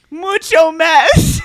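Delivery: very high, loud, drawn-out mock Spanish; Mary's fake Spanish. Repeating Mary's line for laughs, hammed-up fake Spanish accent. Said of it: "I speak fluent Spanish".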